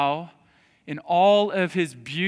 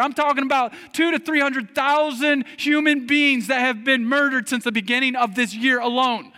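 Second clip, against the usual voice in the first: raised, high-pitched preacher's plea; impassioned preaching; 10 semitones higher, 8 dB louder than usual. Acted. Preacher urging protest for babies' lives, impassioned preaching. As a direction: press the argument hard, voice lifted.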